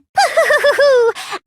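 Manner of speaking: a short, gloating giggle that falls away; mocking laugh. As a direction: laugh at them, pleased with yourself.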